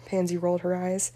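ordinary delivery, nothing marked

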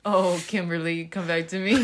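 mock begging an ex to return. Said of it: "[name] wants her back so bad".

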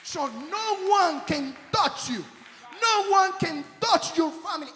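sermon climax, loud and high; preaching shout. Real, not acted. Preacher declaring protection.

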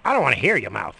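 loud snappy wife; snapping wife. Retold or imitated. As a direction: bark it at him like a cranky wife. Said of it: "She's like".

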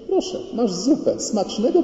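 high sing-song mock hostess; imitated sweet, high-pitched wife; smiling false sweetness. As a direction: serve it up with a sugary put-on smile. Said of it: "Uśmiech".

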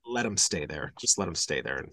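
low, soft muttered aside; wary aside. Real, not acted. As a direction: mutter it under your breath. Wary aside about giant spiders.

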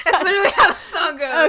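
loud laughing words. Real, not acted. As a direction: talk through a burst of laughter. Laughing after singing.

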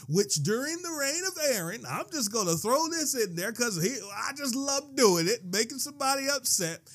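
pitched high in preacherly relish; gleeful preaching. Real, not acted. Preacher teasing a point that upsets people, gleefully excited.

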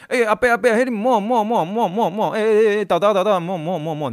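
put-on high, loud call across the gym to stop the old man; urgent shout. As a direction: yell at the uncle to stay put, alarmed. Urgently calling a patient to stop. (高声急喊阿伯别动)

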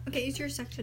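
ordinary talk, nothing marked

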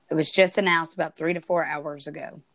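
normal talking voice

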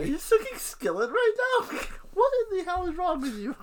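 high, loud laughing disbelief. Real, not acted. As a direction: squeal it out through laughter.

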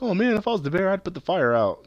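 mock the dopey kid; squeaky imitation of a clueless kid; dumb kid voice. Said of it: "that stupid kid's like".

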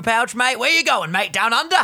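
high, hammed-up Aussie accent; amateur, playful Australian. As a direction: call out in a mock Australian accent. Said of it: "do a good Australian accent".

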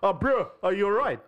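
high, loud London attempt slipping into South African; botched London accent. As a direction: imitate an actor's failed London take, South African showing through. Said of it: "He probably had to retake that scene so many times".

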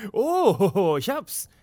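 normal talking voice

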